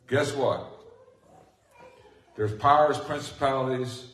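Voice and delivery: ordinary talk, nothing marked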